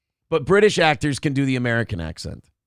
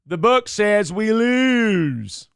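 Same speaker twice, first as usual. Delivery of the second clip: Keanu Reeves; 7 semitones higher, much slower than usual. Acted. Mocking Keanu in The Devil's Advocate, overblown Southern accent. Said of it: "he's talking like this".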